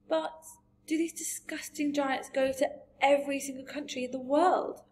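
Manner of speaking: lightly played appalled child's question; Sophie. A narrator's reading. Voices Sophie in story.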